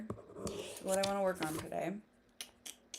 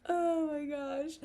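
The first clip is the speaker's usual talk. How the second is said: laughing; 6 semitones higher, much slower than usual. Real, not acted. Still laughing.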